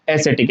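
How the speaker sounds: एस्थेटिक said "e-SE-tik", with an s and no k; correct soft C pronunciation. Shown as a demonstration. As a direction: say एस्थेटिक with a soft s sound in the middle. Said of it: "इसका correct pronunciation होगा". (एस्थेटिक को "ए-से-टिक" बोलें)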